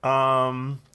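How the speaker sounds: talking around chewing gum; words through a mouthful of gum. Real, not acted. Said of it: "I'm chewing gum again".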